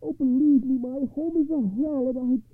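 high-pitched wailing poor man; desperate, high-voiced complaint; crying out in distress. A narrator's reading. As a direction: lament your troubles in a very high voice. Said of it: "he cried".

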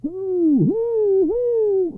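high, loud drawn-out chant; shrieked high chorus cry; crowd crying out. A narrator's reading. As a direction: call it out like a whole town. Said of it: "cried the people of Chelm".

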